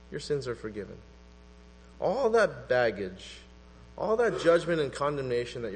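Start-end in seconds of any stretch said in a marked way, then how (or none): 0.1-1.0 much softer and lower, gently savoring it; tender soft repeat. Shown as a demonstration. Preacher softly repeating forgiveness to the congregation, tender. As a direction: let the words land softly, like a gift. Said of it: "Isn't that beautiful?".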